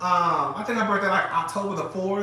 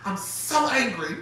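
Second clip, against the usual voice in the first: drawn-out, higher mock-angry voice; 4 semitones higher, slower than usual. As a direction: imitate her prim anger, stretching the words.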